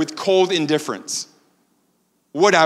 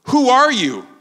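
challenging question; 6 semitones higher, 4 dB louder than usual. Retold or imitated. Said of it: "they say to him".

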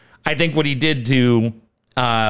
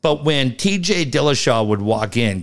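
normal talking voice

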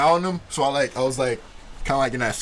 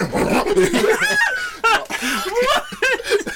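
long, high mock roar; drawn-out hammed-up attack noise; imitated monster cry. Grown man bear crawling at kid, mock roar.

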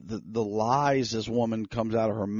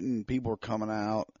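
ordinary talk, nothing marked

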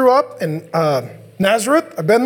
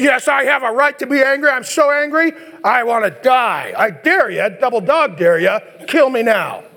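mock tantrum; 3 semitones higher than usual. Jonah daring God to kill him, petulant tantrum. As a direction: dare him like a sulking kid. Kid throwing a fit.

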